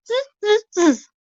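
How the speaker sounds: high mocking buzz; mock him back like a monkey; high monkey chatter. Monkeys mock the peddler back, high buzzing.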